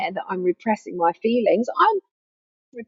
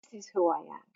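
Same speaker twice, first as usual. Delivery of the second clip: much softer, lower and settled; soft sincere answer; 5 semitones lower, 13 dB quieter, faster than usual. Shown as a demonstration. Pointing to the heart, a soft sincere answer. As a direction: say it gently, meaning it.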